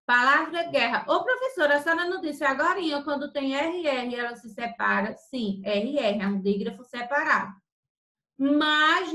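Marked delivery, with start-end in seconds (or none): 1.1-5.1 higher, quick imagined pupil piping up; pupil's question. Acted. Teacher voicing a puzzled pupil calling to her, quick higher query.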